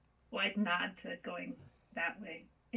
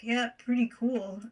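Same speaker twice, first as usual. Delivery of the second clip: slow, louder, higher approval; reacting approvingly; 4 semitones higher, 9 dB louder than usual. Player voicing a reaction to something cool, approving. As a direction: say it loud and drawn out. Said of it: "I'd be like".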